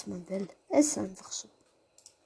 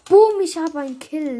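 loud triumphant shout; excited shout; 6 semitones higher, 10 dB louder than usual. Real, not acted. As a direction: celebrate the kill out loud. Getting a kill in game.

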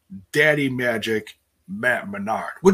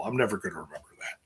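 fast whispered self-aside; 5 semitones lower, 9 dB quieter, much faster than usual. Real, not acted. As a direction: mutter it under your breath, quickly. Aside to self while reading.

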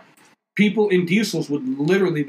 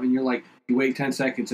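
usual speaking voice

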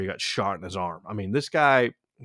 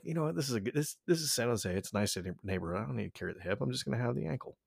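musing to himself; 10 dB quieter, faster than usual. Retold or imitated. Retelling cousin reasoning about leaving his hip gun, musing. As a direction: talk it over quietly with yourself.

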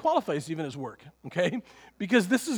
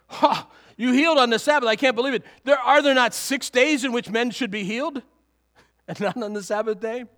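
loud, higher mock outrage; indignant ruler; 4 semitones higher, 5 dB louder than usual. Preacher voicing the synagogue ruler scolding Jesus, indignant. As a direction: scoff at the healer like an offended official. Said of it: "His indignation says".

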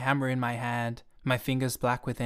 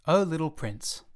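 plain reading voice

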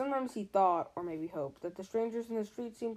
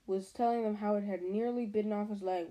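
plain reading voice

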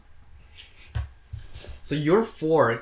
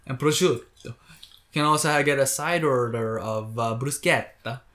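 hammed-up Italian pronunciation. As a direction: savour each Italian word like a snooty diner. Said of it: "And then there was the mozzarella".

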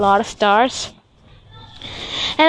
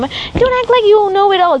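high, louder mock mom reprimand; sharp, high mimicry of a mother's rebuke; scolding mom; 10 semitones higher, 3 dB louder than usual. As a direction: imitate mom telling you off.

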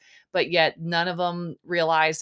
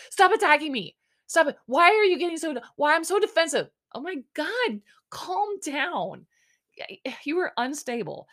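mock defensive whine; 8 semitones higher than usual. Lace snapping at the guys, in a high mocking whine. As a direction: whine back like a cornered diva.

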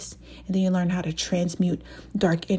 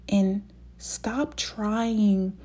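normal talking voice

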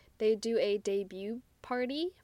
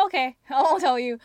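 louder, higher mock agreement; mock concession; 4 semitones higher, 11 dB louder than usual. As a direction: act out giving in, loudly. Rewrites the scene's dialogue, acted.

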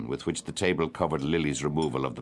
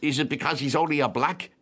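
high, pointed challenge in question form; sharp; 8 semitones higher than usual. A narrator's reading. Guest defending a singer to the table, sharp. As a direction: press the question sharply, pitched up. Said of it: "asked Freddy Malins sharply".

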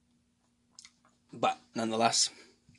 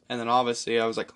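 ordinary talk, nothing marked

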